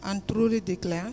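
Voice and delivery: speaking up. Real, not acted. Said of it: "Can you speak louder".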